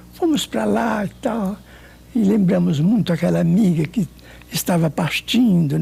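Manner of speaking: ordinary delivery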